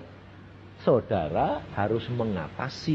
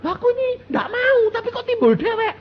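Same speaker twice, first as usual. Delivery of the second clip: put-on much higher, whiny puzzled kid voice; confused teen; 5 dB louder than usual. Teen confused about her own feelings, high and whiny. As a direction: play the bewildered teenager. Bingung dia.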